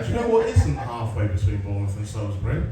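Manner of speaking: normal talking voice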